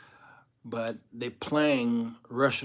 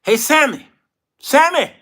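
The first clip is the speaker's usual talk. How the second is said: high, loud wake-up call; waking someone up; 14 semitones higher, 15 dB louder than usual. Acted. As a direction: call out to rouse a sleeper. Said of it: "Woke 'em up".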